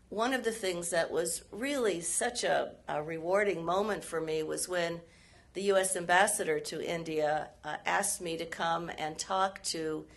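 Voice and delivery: usual speaking voice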